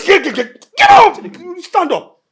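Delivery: very high, loud shouted commands; angry screamed dismissal; furious order. Acted. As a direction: explode and order him out. Boss orders worker out.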